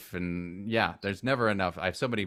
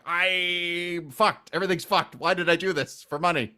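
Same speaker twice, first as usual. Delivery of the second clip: put-on higher, louder panicked old man; mock-terrified rant, higher and louder; scared old man; 10 semitones higher, 7 dB louder than usual. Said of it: "He's like a scared old man".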